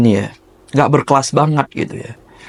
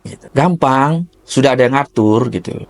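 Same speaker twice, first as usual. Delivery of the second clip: ordinary talk, nothing marked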